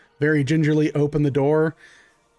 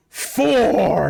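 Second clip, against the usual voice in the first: higher, louder monster bellow; called-out count; 6 semitones higher, 4 dB louder than usual. Acted. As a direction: call the last number out across the yard.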